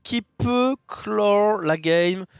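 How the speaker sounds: high, loud, amazed commentary; excited caster stretching out the big moment; excited play-by-play. Real, not acted. Caster reacts to game-changing card.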